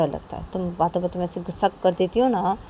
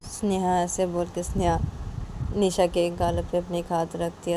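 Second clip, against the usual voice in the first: ordinary delivery, nothing marked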